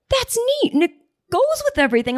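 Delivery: high-pitched mock admiration; impressed delivery guy. Furniture guy praising customer's color scheme, impressed.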